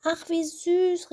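put-on high, slow, fond aww over a baby; delighted coo. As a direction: melt at the sight of the baby. Women coo over found baby.

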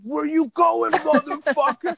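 high, loud taunting yell; drunk guy yelling. Retold or imitated. Drunk attacker chasing the speaker, loud taunting yell. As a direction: bellow it after a fleeing man. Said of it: "And he's like".

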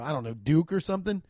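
ordinary delivery

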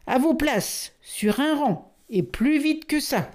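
high, commanding general's orders; barking general. Acted. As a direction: bark the troops into line. (ordres aboyés d'un général, aigus)

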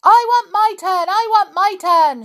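loud, high demanding shout. A narrator's reading. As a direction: yell it like a spoiled doll wanting her turn. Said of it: "shouted Amelia".

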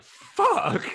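high, loud burst of panic; sudden yelp. Retold or imitated. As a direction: blurt it out in alarm. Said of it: "And I was like".

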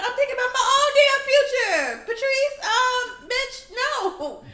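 loud, high shout at Patrice; angry shout. Retold or imitated. Retelling a fight with Patrice over the last milk, shouting. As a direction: yell it right in her face. Angry retort to old lady.

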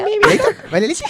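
put-on squeaky high baby talk. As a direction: coo it like a baby. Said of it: "si quiere un heladito, repite".

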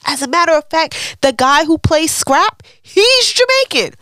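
high, loud indignant outburst; worked-up, emphatic protest; exasperated rant. Real, not acted. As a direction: argue it hotly, pitch shooting way up. Ranting about fake Jamaican casting.

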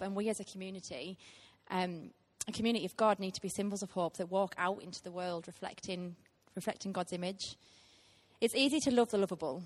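ordinary talk, nothing marked

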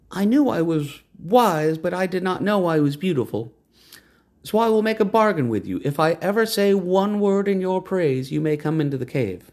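high, proud woman's voice; lofty woman naming the horse. A narrator's reading. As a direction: announce the horse's new name.

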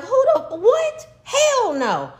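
high, loud, laughing "what?"; outraged laughing refusal; a mother floored by her son's ask; shocked disbelief. Retold or imitated. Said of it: "I'm looking at him like".

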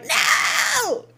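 high put-on cry; mock cry of no. Speaker mocks own horror, high cry. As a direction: wail no like a horrified fan. Imagined reaction, shouting.

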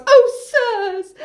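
high-pitched dramatic shout. Acted. Oedipus as his daughter is grabbed, high mock shout. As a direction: cry out in hammed-up alarm. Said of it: "Oedipus shouts".

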